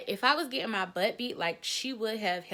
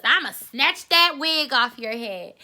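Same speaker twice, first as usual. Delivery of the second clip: taunting threat; 4 semitones higher, 7 dB louder, slower than usual. Retold or imitated. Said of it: "her number one favorite thing to say to me".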